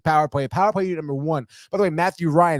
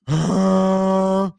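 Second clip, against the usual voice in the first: long, heavy acted gasp for air; winded exhale; 3 semitones higher, 3 dB louder than usual. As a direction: blow out air like an exhausted player. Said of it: "Kakko out of breath".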